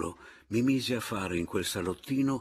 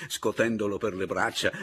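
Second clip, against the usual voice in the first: usual speaking voice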